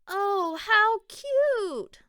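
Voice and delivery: high, loud gush over something cute; delighted squeal. Acted. Mona finding the littlest pumpkin, high delighted squeal. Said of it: "she said".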